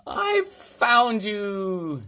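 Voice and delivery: high, loud, slow joyful exclamation; a boy overjoyed to find the Yeti at last; happy cry. A narrator's reading. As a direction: draw it out with a big grin. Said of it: "cried George with a big happy grin".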